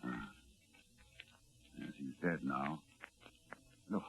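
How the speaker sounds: hushed awe. Acted. Doctor urges Rice to watch the creature dissolve, hushed with dread. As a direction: hold it down, horrified and hushed.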